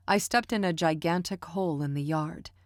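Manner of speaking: ordinary delivery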